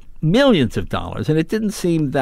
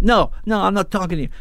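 higher-pitched mock yell; mildly shouted refusal, raised in pitch; retold angry refusal; 5 semitones higher, faster than usual. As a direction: imitate a driver angrily brushing someone off. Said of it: "he's just screaming".

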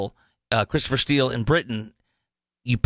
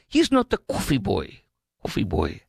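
Greek accent imitation; 6 semitones lower than usual. Wife insists on TV in a light mock Greek accent. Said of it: "a Greek or foreign accent".

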